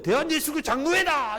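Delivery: put-on high childish triumphant cry; the kid's winning comeback, pitched high; elder's son triumphant. 장로 아들이 이겼다고 외치는 높은 아이 목소리.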